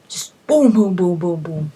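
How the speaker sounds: whack it out like sword hits; boom sound effects. Retold or imitated. Gamer acting out hitting the dragon, punchy booms.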